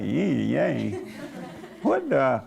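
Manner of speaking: laughing through a mock-baffled echo; half-laughed mock confusion; amused disbelief. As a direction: scoff at the phrase with a laugh. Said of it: "I'm like".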